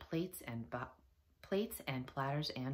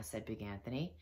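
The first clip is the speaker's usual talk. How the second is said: usual speaking voice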